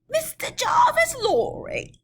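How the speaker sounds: a high, halting reply; rider voice. Acted. Rider from the mist asking for a passenger, high.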